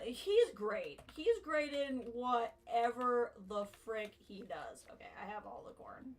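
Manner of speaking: ordinary delivery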